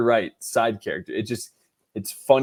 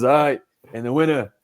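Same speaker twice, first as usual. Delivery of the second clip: Al Pacino; 3 dB louder than usual. Acted. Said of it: "And he goes".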